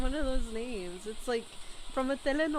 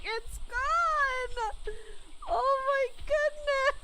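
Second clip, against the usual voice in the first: mock wail; 14 semitones higher, much slower than usual. Real, not acted. Lost all wood and stone.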